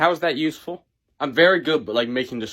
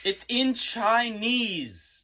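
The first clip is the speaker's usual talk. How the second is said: drawn out, a bit higher; very slow; 7 semitones higher, much slower than usual. Acted. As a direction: stretch every word slowly. Slowed repeat in argument.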